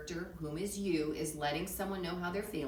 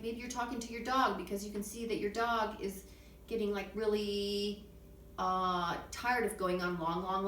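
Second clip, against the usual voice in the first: ordinary talk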